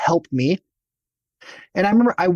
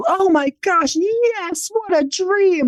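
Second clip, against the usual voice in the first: very high, gushing thrill; gushing delight; 14 semitones higher, much slower than usual. Retold or imitated. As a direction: mimic giddy coworkers. Teammates thrilled at invitation.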